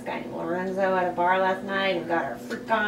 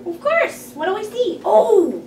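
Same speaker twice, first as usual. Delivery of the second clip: excited; 10 semitones higher, 5 dB louder than usual. Acted. Karen eager about a movie date.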